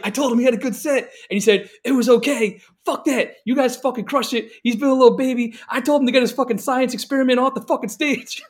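high, loud mock outrage; imitated indignant rant. Bandmate imitating a friend's rant about a sulking musician, loud mock outrage. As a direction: rant like a fed-up friend, voice pitched right up.

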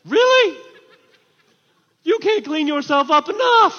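loud, high challenge to the crowd; incredulous preaching shout. Real, not acted. Preacher challenges those getting cleaned up for New Year, incredulous shout. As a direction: cry out in disbelief.

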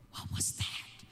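unvoiced, hushed retold question; whispered question. Father questioning son about a strange sound, whispered. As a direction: whisper it as the startled visitor.

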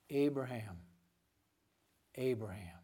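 a hint of God's tender voice from heaven; intimate call. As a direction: speak the name twice like a close friend. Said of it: "this sense of intimacy between God".

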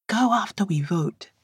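whisper. A narrator's reading. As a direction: whisper it as a quick aside. Tapping her arm, aside.

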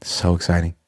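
low, unenthused sarcasm; dry, sinking monotone; deadpan fake excitement. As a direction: claim excitement with none in the voice. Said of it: "Can you hear the excitement in my voice?".

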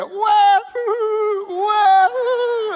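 loud high baby wail imitation; mock baby wail. Father holding his crying baby, loud high mock wail. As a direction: howl like a crying infant.

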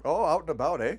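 mock Canadian accent. Friend teasing cohost's supposed accent, mock Canadian accent. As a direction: tease the guy with a thick Canadian accent. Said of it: "a real hard Canadian accent".

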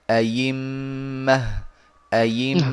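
أيمة with the hamza replaced by a kasra-ya, drawn out slowly; slow demo of أيمة with ya for hamza. Shown as a demonstration. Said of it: "يبدلون الهمزة ياء مكسورة فيقولون". (إبدال الهمزة ياء في أيمة)

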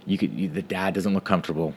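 normal talking voice

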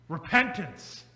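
ordinary talk

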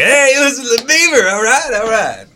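loud, very high radio DJ hype; hammed-up enthusiasm. Shown as a demonstration. Former DJ showing how he used to plug his station, exaggerated enthusiasm. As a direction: whoop it up like an over-excited station jingle. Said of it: "say it with a lot more enthusiasm".

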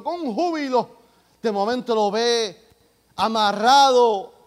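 emphatic preaching story, phrase by phrase; relato predicado. Real, not acted. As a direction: build the story with loud stressed words. Preacher tells story at full force. (narración de predicador con fuerza)

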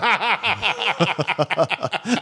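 long, high burst of laughter; helpless peals of laughter; laughing. Real, not acted. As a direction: crack up at the punchline. Laughs at his own story.

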